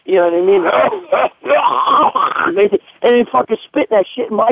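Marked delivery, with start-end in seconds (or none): 0.7-2.8 imitated hawking. Bouncer demonstrating the all-night hawking, revolting throat noise. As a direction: imitate hacking up a throatful of phlegm. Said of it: "He's been hawking".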